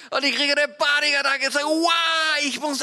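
high, loud panicked outcry; panicked shout. Retold or imitated. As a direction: cry out in sudden panic. Panic seeing empty driver seat.

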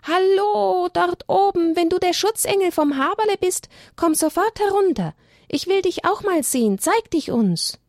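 high, loud call up into the tree. A narrator's reading. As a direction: shout it up to the treetop. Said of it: "rief ganz laut".

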